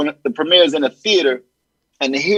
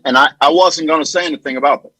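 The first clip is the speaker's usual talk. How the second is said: normal talking voice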